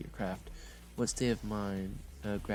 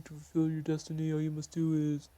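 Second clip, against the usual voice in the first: high ghost voice, lighter; resume the spirit's message; ghostly prophet; 7 semitones higher than usual. Acted. Ghost starts the prophecy over, high eerie voice.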